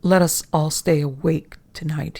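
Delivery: ordinary delivery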